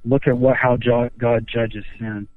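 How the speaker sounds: ordinary delivery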